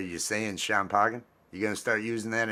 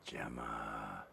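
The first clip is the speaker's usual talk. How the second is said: Gemma said low and falling; hushed hint; quiet coaxing name; soft murmur; 13 semitones lower, 18 dB quieter than usual. Shown as a demonstration. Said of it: "and you say".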